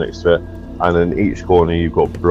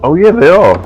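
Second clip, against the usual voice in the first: surprised; 12 semitones higher, 8 dB louder, faster than usual. Real, not acted. Realizes the spears are axes.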